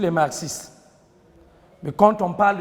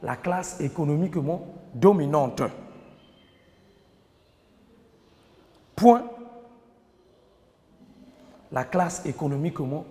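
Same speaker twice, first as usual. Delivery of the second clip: dictating. Shown as a demonstration. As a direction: dictate phrase by phrase, pausing between. Teacher dictating to class. (dictée lente, en détachant chaque groupe de mots)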